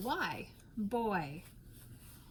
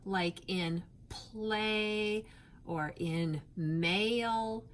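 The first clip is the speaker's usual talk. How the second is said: "play" and "mail" drawn out slowly; slow the words "play" and "mail" so the vowel is heard; slow classroom modelling of "play" and "mail"; stretched phonics words; much slower than usual. Shown as a demonstration. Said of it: "how we made the sound A".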